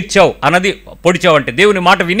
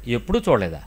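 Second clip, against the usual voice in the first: much lower and much softer echo of ఎప్పుడు చూడలేదా, leaning on ఎప్పుడు; incredulous echo; 10 semitones lower, 11 dB quieter than usual. Shown as a demonstration. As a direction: hush it back at her in disbelief, stressing ఎప్పుడు. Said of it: "అంటే". (ఎప్పుడు పై ఒత్తిడితో తగ్గిన, మెల్లని ఆశ్చర్యపు ప్రతిధ్వని)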